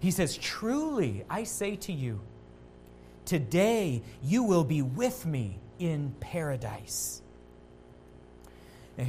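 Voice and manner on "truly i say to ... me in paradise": soft solemn promise. Retold or imitated. Jesus answers the repentant criminal softly and slowly. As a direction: promise it gently, unhurried.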